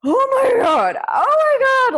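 high, loud mock shriek of panic; acted-out conniptions. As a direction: scream it as if having a hysterical fit. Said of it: "like, conniptions".

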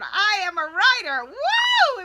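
shouted high and loud with joy; loud declaration. Retold or imitated. Coach recalling her first declaration, shouted proudly. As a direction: belt it out like a triumphant claim. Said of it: "the first time I declared it".

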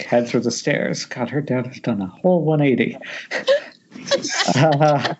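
ordinary talk

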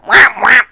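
high, loud croaked cat cry; strained cat squawk. Retold or imitated. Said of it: "strains its fucking vocal cords".

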